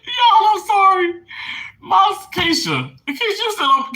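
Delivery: overwhelmed, crying out at a high pitch; tearful. Real, not acted. Sister's message moves her, real tearful outburst. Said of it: "don't make me cry".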